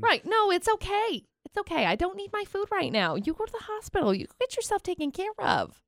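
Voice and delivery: high, soft, overly sweet customer voice; mock understanding customer. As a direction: play the saintly customer who waves the food away.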